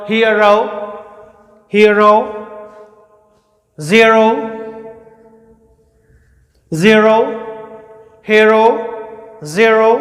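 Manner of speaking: हीरो as hia-rau, ज़ीरो as zia-rau; emphatic model words, हीरो and ज़ीरो with gliding vowels; corrected pronunciation. Shown as a demonstration. Teacher drilling students, firm hia-rau and zia-rau. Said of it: "इसका pronunciation ऐसे होगा".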